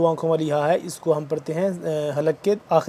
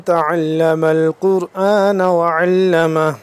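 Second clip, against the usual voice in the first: slow, drawn-out melodic tajweed recitation; Quran recitation; 3 semitones higher, 7 dB louder, much slower than usual. Acted. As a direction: recite it slowly with full tajweed. (قرآن کی تلاوت، آہستہ اور سریلی)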